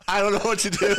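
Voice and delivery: high, loud, through real laughter; laughing helplessly. Real, not acted. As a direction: say it while cracking up. Flustered, laughing over awkward wording.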